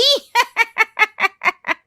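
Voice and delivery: high, loud taunting laugh; mocking laugh. Aunt-in-law mocks her sister-in-law, laughing. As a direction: laugh at your own jibe.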